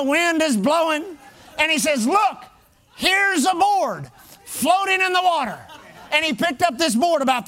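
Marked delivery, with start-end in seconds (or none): 2.1-5.6 higher, mock-urgent live report; hammed-up storm reporter. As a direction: imitate a reporter calling out over the wind. Mocking a dramatic storm reporter.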